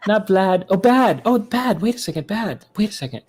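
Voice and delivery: high, put-on pop star voice. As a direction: puzzle over a lyric in a high mock voice. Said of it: "So he goes like this".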